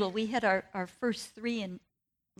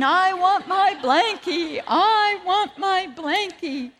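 put-on high, loud, slow wailing child; boy crying for his blankie to play with the pain; wailing toddler; 8 semitones higher, 9 dB louder, slower than usual. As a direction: drag out the toddler's whiny cry for the blanket. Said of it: "he just kept crying".

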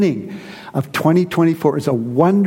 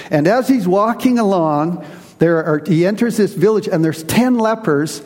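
usual speaking voice

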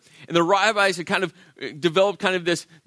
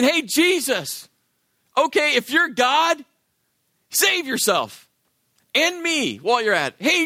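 mocking taunt; 7 semitones higher than usual. Thief on the cross taunting Jesus, mocking jeer. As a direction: sneer up at him from the next cross. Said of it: "starts mocking Jesus".

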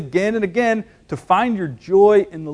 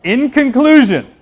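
higher, louder hammed-up pulpit voice; mock preacher; 8 semitones higher, 6 dB louder than usual. Pastor mocking a long-winded preacher, hammed-up pulpit wind-up. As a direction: ham up a preacher's grand wind-up. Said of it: "A typical preacher thing to do".

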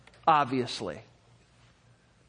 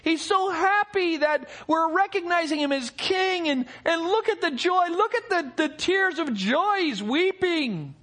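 mock onlooker; 7 semitones higher than usual. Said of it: "some of the people probably looked and said".

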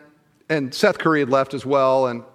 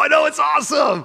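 high, loud gushing squeal; gushing excitement; 13 semitones higher, 4 dB louder than usual. Retold or imitated. To a friend about the Kings, an excited high squeal. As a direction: gush like a starstruck fan.

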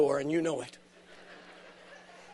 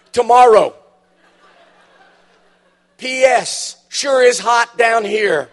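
dead husband's letter; 4 semitones higher, 8 dB louder than usual. Acted.

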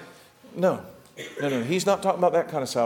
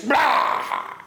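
comic splat noise. Retold or imitated. Enemies falling on their faces.

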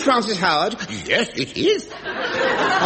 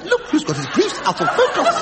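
different voice; 8 semitones higher than usual. Shown as a demonstration. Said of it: "Different voice".

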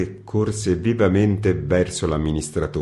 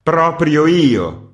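higher, louder, slow furious outburst; angry exclamation; 9 semitones higher, 7 dB louder, much slower than usual. Acted. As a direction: spit the words out in rage. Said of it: "esclamò rabbiosamente il redattore". (esclamazione rabbiosa, più alta e forte)

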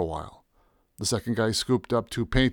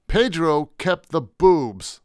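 higher, slow, emphatic realisation; renewed energy; 5 semitones higher, 4 dB louder, much slower than usual. A narrator's reading. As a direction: land each word as a sudden realisation. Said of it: "with a renewed burst of energy".

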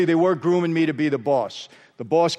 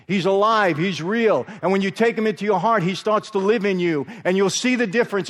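raised, fervent preacher's voice; preaching; 3 semitones higher than usual. Acted. Preacher urging the congregation, raised and fervent. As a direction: preach it out to the whole room.

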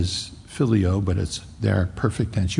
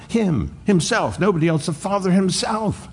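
rising emphasis; 7 semitones higher than usual. Real, not acted. Preacher building to emphatic point.

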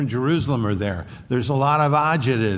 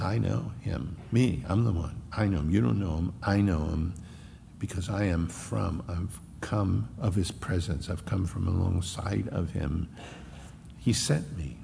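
quiet; 5 semitones lower, 6 dB quieter than usual. Acted. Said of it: "There's an emphasis".

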